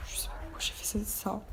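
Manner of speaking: chuchotement pour soi-même; chuchoté. Real, not acted. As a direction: murmurer l'aparté presque sans voix. Aparté gêné pour elle-même. (murmure gêné)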